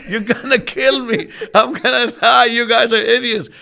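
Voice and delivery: loud, panicked mock scream of a passenger; imitating a panicked scream. Client trapped in runaway car, mock panicked scream. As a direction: shout it like he's trapped in a rolling car. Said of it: "The guy inside the car screaming".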